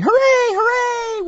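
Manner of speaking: tiny high-pitched mascot cheer; squeaky cheer. Acted. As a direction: cheer like a tiny cartoon creature.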